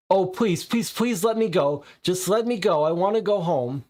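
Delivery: high, loud pleading; terrified begging. Acted. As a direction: beg to be let go, panicked. Begging the attacker for release.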